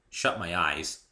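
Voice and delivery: ordinary delivery, nothing marked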